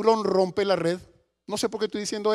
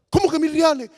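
high, startled father's question; imitated astonished outcry; acted disbelief; 5 semitones higher, 3 dB louder than usual. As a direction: burst out incredulous at the news.

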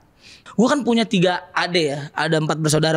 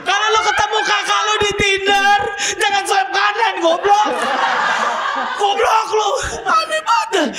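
much higher, shouting in disbelief; excited high rant; 11 semitones higher than usual. Real, not acted. Excited rant about Tinder sister. (berteriak heboh dengan nada tinggi)

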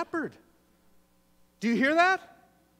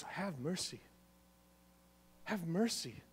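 hushed plea; 4 semitones lower, 12 dB quieter than usual. Acted. Quiet earnest plea.

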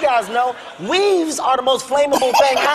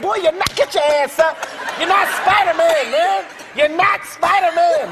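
higher, exasperated dad scolding, half-joking; mock angry yell; 6 semitones higher than usual. Dad scolding his son who plays Spider-Man, mock angry yell. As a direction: scold the boy like a fed-up dad, played for laughs. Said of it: "I yelled, I got so mad".

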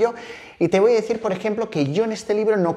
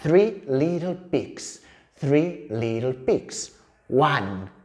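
very slow, drawn-out English words; slow, rhythmic picture-book chant; storytelling for toddlers; much slower than usual. Shown as a demonstration. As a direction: count it out slowly for a tiny child. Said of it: "otra vez lo mismo".

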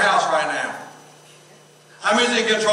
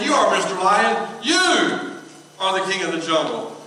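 normal talking voice

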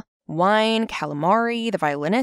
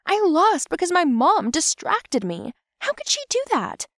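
upset outburst; 10 semitones higher than usual. Acted. Girl losing control after losing, high indignant outburst. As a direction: blame her, pitch climbing very high.

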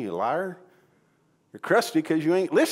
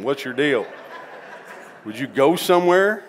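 laughing mock annoyance; 2 semitones higher, 6 dB louder than usual. Pastor voices himself annoyed at kid.

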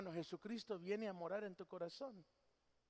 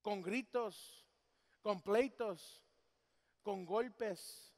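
slow weighted list; much slower than usual. Real, not acted. Slow listing of abuses.

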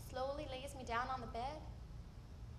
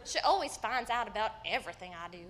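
ordinary talk

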